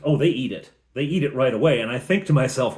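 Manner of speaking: usual speaking voice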